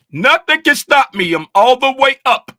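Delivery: high, loud cocky shout; triumphant boast. Pretend. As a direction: crow like the unstoppable chart. Voicing price pushing up.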